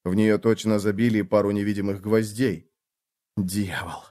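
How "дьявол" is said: barely voiced, soft curse; mouthed whisper. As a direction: breathe the curse out silently. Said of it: "прошептал я одними губами".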